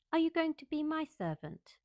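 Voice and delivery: put-on high, commanding little girl's question; spoiled girl interrogating the new maid, high and imperious. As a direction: demand it like a spoiled mistress. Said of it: "in her imperious little Indian way".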